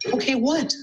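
high, rising question. Acted. Carla prompting Buster to ask, high rising question. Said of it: "asked Carla".

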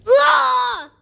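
loud, drawn-out high scream; frustrated scream. Real, not acted. Player failing the climb, frustrated scream. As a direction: yell out in frustration. Falls in the game.

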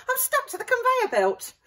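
high, loud panicky voice; panicked plea. A narrator's reading. Carrot stuck on the conveyor belt, panicking. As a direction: beg to be freed in alarm. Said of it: "cried Carrot".

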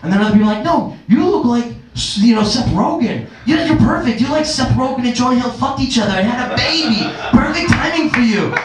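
lightly hammed-up excitement; mock gushing people. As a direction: gush over him like a casting fan. People gushing about his look.

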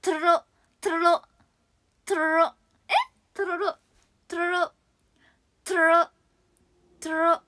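トロロ with rolled r's. Shown as a demonstration. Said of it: "ルカロで終わることは".

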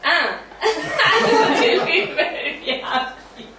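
high, breaking laughter over the words; helpless laughter that tips toward crying; laughing through tears. Acted. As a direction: laugh it off while it hurts. Ross laughing through heartbreak.

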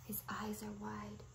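soft unvoiced whisper; hushed observation. Real, not acted. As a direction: whisper the detail. Hushed narration.

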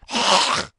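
loud, high throat-clearing squawk; sudden harsh wordless outburst; creature exclamation. A narrator's reading. Gryphon breaking a long silence, loud rasping exclamation. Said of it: "an occasional exclamation of".

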